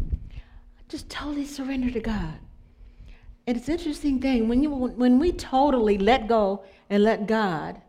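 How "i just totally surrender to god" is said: soft whispered surrender; hushed, intimate confession of surrender. Real, not acted. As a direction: whisper it, letting go completely.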